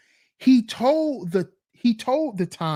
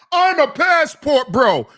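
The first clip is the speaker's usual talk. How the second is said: higher, louder mocking boast; mock the rapper's proud claim; 5 semitones higher, 6 dB louder than usual. Vlogger mocking [name]'s passport bro boast. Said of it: "He waits all these years".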